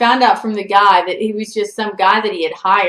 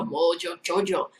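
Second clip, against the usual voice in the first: softer cartoon villain impression with a mock accent; Mojo Jojo; 3 semitones lower, 6 dB quieter than usual. Comparing her stalker to a cartoon villain, accented villain voice. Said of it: "He sounded kinda like Mojo Jojo".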